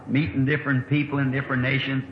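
usual speaking voice

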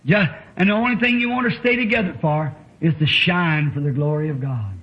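normal talking voice

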